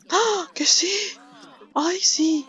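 exclamaciones agudas y fuertes de alegría; emocionada. Real, not acted. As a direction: celebra el dinero a gritos. Alegría al recibir dinero. (grito de alegría al recibir el dinero)

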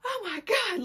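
high-pitched mock panic; retold panic. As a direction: imitate a panicked gasp up high. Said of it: "I start panicking".

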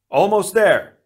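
high, loud battle yell; shouted. A narrator's reading. Tahu urges the Toa on in battle, shouted. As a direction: shout encouragement over the fight. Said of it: "Tahu shouted".

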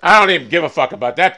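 ordinary talk, nothing marked

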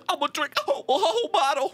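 mock crying Kendrick. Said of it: "[name] crying".

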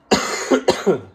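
heftiger echter Husten; kräftig abhusten, erkältet. Real, not acted. Sick vlogger coughing. (erkältete Vloggerin hustet mitten im Satz)

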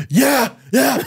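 put-on high, eager toddler yelps; high-pitched mimicry of a delighted daughter; excited little girl imitation. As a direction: squeal it like an overjoyed small kid. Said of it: "I've been imitating her a lot".

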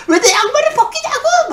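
shrieking high, very loud demand; furious accusation. Retold or imitated. Patient screams at man taking off her socks, furious shriek. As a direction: scream it in outrage. Retold outburst after slapping caretaker.

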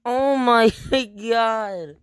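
high-pitched, through real laughter; words broken up by high laughter; laughing. Real, not acted. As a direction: gasp it out while cracking up. Still laughing at the scene.

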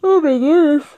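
real yawn through the words. Real, not acted. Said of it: "Sorry, I just yawned".

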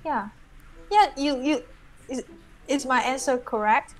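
ordinary delivery

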